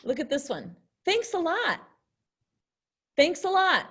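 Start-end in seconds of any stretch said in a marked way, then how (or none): 1.0-1.8 sarcastic. Shown as a demonstration. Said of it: "they're being sarcastic". 3.2-3.9 higher, louder cheerful thanks; happy. Shown as a demonstration. Teacher showing a happy thank-you to students. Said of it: "they're really happy".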